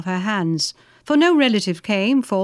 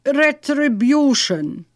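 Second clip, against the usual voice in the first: very slow captive's voice, pitched up then falling; captive Turk; 4 semitones higher, much slower than usual. A narrator's reading. Captive Pasha naming his fate to the knight, slow character voice. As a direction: draw the word out slowly, falling at the end. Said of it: "replied the Turk".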